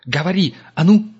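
loud, rising command; shouted demand. A narrator's reading. Gerda demands an answer.

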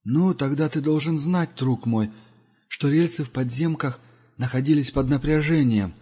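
lightly voiced wise old pilgrim; Duga's voice. Duga's voice in the story. (голос мудрого странника)

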